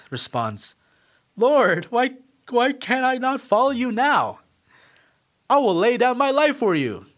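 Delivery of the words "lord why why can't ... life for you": higher and louder, eager brash protest; brash Peter. Acted. Peter protesting to Jesus, brash and eager. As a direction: blurt it out like headstrong Peter. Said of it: "being a strong-willed, brash person".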